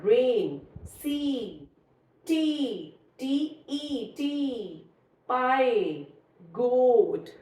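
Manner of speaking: "rain, सी, टी, पाई, goat" stretched out word by word; long first vowel. Shown as a demonstration. Teacher lists vowel digraph examples, "rain, सी, टी, पाई, goat" with drawn-out vowels. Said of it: "long sound of the first vowel".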